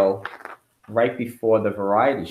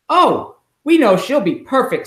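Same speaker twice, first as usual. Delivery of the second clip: higher, louder admiring voice; admiring man in the crowd; 12 semitones higher, 5 dB louder than usual. Acted. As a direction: gush with confidence. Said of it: "said a man".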